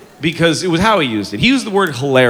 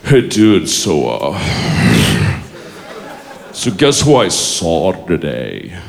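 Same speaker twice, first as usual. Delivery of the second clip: low, slow, slack-jawed dude voice; dopey bro drawl; 13 semitones lower, slower than usual. Retold or imitated. Imitating the guy overheard greeting his friend, low and lazy. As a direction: let the words slide out lazily, low. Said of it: "he goes".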